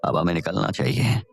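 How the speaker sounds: a proud father calling his darling; fond father. Acted. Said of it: "कहते हुए विजयराज ने".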